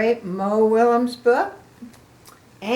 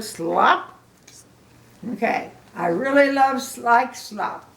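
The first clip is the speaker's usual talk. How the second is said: usual speaking voice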